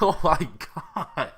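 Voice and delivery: high, loud, slow laughing gasp; laughing disbelief. Retold or imitated. Reliving own reaction to the episode, laughing in awe. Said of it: "I'm like".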